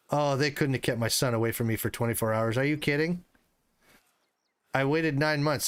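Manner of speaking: normal talking voice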